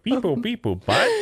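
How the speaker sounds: high chirpy droid beeps; Star Wars droid. Pretend. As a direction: beep like a little droid. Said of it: "sounded like a little Star Wars droid".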